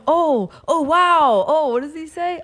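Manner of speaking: imitating faked alarm; fake panic. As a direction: overact mock alarm at the mishap. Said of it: "he better fake it".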